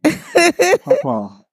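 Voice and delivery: high, loud call of his name; calling out to Papa. Real, not acted. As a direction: holler across the kitchen.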